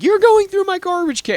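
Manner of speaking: mimicking a fussy homeowner; mock angry guy. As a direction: sneer it like the uptight neighbor. Mocking the angry neighbor.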